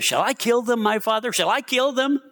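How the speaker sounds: high-pitched, keen questioning; eager king. A narrator's reading. King of Israel begs Elijah to let him strike, high and eager. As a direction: ask it like begging for permission.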